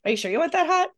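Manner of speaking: quoting the barista with mock doubt; a hint of a puzzled barista; doubtful barista.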